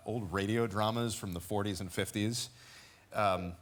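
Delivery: ordinary talk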